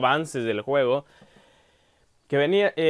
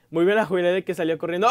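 normal talking voice

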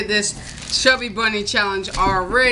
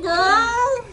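ordinary talk, nothing marked

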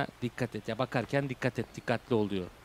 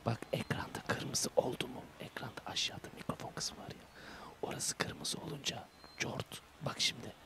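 whisper; 13 dB quieter than usual. Real, not acted. Streamer whispering about the red mic bar. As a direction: breathe the words quietly. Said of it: "full böyle konuşmam lazım".

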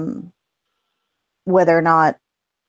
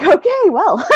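laughing shrug; 12 semitones higher, 4 dB louder, slower than usual. Retold or imitated. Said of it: "So I'm like".

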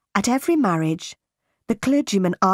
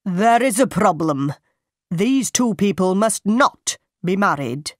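a raised, carrying voice from the back of the church; loud declaration; 3 dB louder than usual. Acted. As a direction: call it out firmly to stop the wedding. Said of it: "He spoke loudly".

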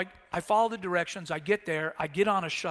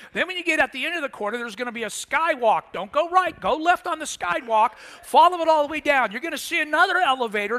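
loud, higher bus driver barking turns; insistent driver reeling off a route; rapid-fire directions; 6 semitones higher, 8 dB louder than usual. Retold or imitated. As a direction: overwhelm him with instructions.

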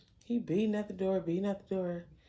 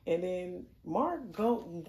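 normal talking voice

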